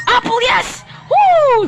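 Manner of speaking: high loud whoop; triumphant woo. Real, not acted. Gamer celebrating a found ingredient, loud high whoop. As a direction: celebrate the find.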